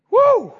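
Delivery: high loud whoop; excited whoop. Real, not acted. Preacher whooping to the church, joyful whoop. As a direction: let out a joyful woo.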